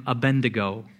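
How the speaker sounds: "Abednego" with an N before the D, a-BEN-de-go; common mispronunciation. Shown as a demonstration. Preacher quoting the congregation's error, "Abednego" said a-BEN-de-go. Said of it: "Please stop calling him Abednego".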